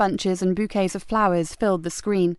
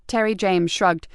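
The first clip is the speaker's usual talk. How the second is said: ordinary delivery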